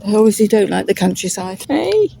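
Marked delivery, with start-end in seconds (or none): 1.7-2.1 sharp high call; high sudden call. Real, not acted. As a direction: catch the dog's attention. Calling out to dog.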